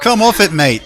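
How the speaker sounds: hammed-up British accent; your best bad British impression; fake British accent. Shown as a demonstration. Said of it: "best you can do for British".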